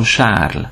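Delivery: Charles in French, starting with "sh". Shown as a demonstration. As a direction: say Charles as "sharl". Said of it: "H betűcsoportot S-nek ejtjük".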